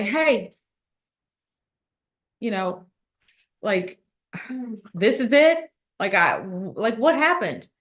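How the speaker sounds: mock indignant protest. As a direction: play at protesting in disbelief. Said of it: "and I'm gonna say".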